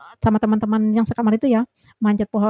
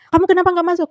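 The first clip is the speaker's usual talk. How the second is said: higher, louder mock telling-off; imitated scolding; 8 semitones higher, 6 dB louder, faster than usual. Leader confronts worker about skipping work, imitated scolding. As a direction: imitate a boss laying into an absent worker. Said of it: "Aku diomel-omelin tuh sama si nenek-nenek sihir ini".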